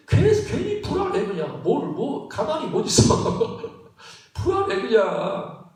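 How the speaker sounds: high-pitched, hammed-up restlessness with a laugh; mock anxious fretting. Preacher imitating someone needlessly uneasy, comically anxious. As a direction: act fidgety and uneasy, voice jumping up high.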